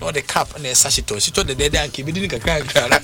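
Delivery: usual speaking voice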